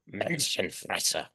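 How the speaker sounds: "Menschenfresser" in a soft, slow, put-on German accent; hammed-up German accent, drawn-out "Menschenfresser"; mock German accent. Shown as a demonstration. Lecturer jokingly tries the German word in a German accent. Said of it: "if you wanna do it in a German accent".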